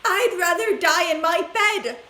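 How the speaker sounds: high loud stubborn shout; grandma shouting her refusal. A narrator's reading. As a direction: dig in and refuse. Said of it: "shouted Grandma Josephine".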